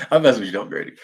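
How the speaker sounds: laughing through quick teasing words. Real, not acted. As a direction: giggle it out fast while teasing.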